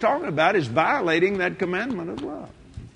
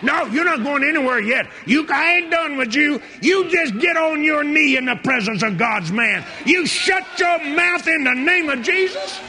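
commanding rebuke; 11 semitones higher than usual. Retold or imitated. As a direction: order him down with full force. Preacher retells driving out the devil.

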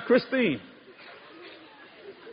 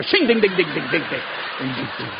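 imitated engine turning over, dropping lower and dying away; old engine cranking; 7 semitones lower than usual. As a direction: sputter dings like a tired starter motor. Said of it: "Sound like an old Chrysler engine trying to turn over".

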